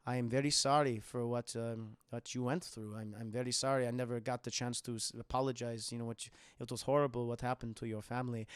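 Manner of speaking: a hint of a soft-spoken Swede saying sorry; soft Swedish guy. [name] apologizes to Danny.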